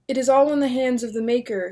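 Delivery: plain reading voice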